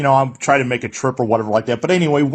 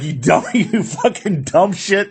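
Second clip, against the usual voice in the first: mock [name]; 5 semitones higher than usual. Fan retelling a sweaty [name] snapping at him in a mock sneer. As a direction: drag out the insult in a mocking put-on voice. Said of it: "the guy just looked at me like".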